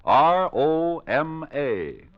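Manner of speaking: R O M A spelled slowly, one letter at a time; slow, spaced-out spelling of the letters R O M A. Shown as a demonstration. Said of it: "I'll spell out the name for you".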